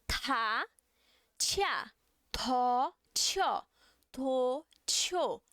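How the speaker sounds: teacher drilling aspirated T syllables; Korean aspirated t drills. Shown as a demonstration. Teacher models the Korean aspirated T for students, breathy puff. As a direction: puff air on ta, tia, to, tio. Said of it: "Então vai ficar".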